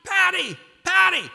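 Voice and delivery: high, loud calls of her name; yelling. Retold or imitated. Husband yelling for his wife down the hall, loud and high. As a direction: shout for her from the bedroom. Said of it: "I immediately yell out".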